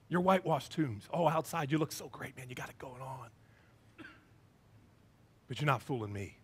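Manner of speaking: low, soft, trailing to almost nothing; quiet rebuke. Acted. Jesus to those who look great outside, quiet knowing rebuke. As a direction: rebuke them under your breath. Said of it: "I can just hear he's got love".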